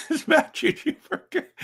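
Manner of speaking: cracking up while naming him; laughing. Real, not acted. Laughing reveal of a name.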